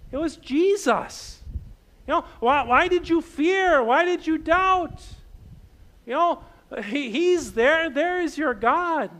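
lightly voiced preacher chiding the disciples; gentle rebuke. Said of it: "we say".

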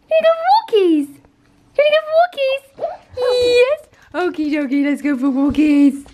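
very high, squeaky pet talk; dog voice. Acted. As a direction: coo it like baby talk to a dog. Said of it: "I'm really sorry about my dog voice".

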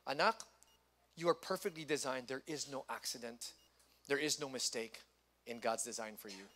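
put-on soft, gentle reassurance to a child; a parent softly reassuring their child. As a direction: comfort your child softly.

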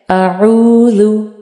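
आऊज़ु drawn out slowly, ain clear from mid throat; a slow, careful आऊज़ु; slow model word. Shown as a demonstration. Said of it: "आइन के लिए जो word है".

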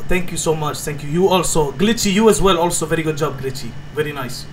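put-on halting broken English in a thick foreign accent.

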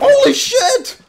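very high, very loud startled shriek; real yelp of shock; shocked scream. Real, not acted. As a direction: scream it out, caught off guard. Startled by game event.

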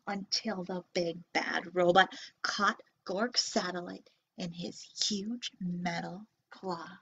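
dropping to a whisper; hushed suspense. A narrator's reading. Narrator hushing kids at the scary moment, whispered.

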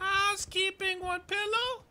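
very high falsetto maid voice; squeaky hotel maid calling; housekeeping voice. Pretend. Echoing the maid bit.

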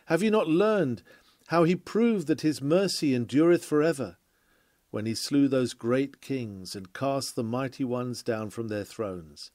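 ordinary talk, nothing marked

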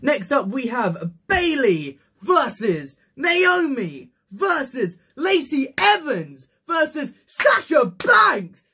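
loud, high drawn-out match announcement; ring announcer. Acted. Host announcing a title match to viewers, ring announcer. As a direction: shout out each name, stretching the vowels.

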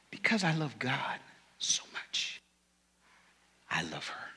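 soft whispered confession; hushed secret. Real, not acted. Husband sharing the secret of his marriage in a whisper. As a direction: drop to a whisper.

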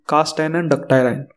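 usual speaking voice

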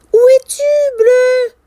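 high, loud call for a friend; searching call. Acted. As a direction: call out for someone missing. Character calls for missing whale.

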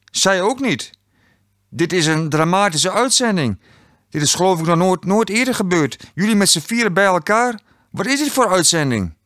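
high, animated exclamations; dramatic astonishment. A narrator's reading. Quiz host telling contestants the broadcast is dramatic, astonished and high.

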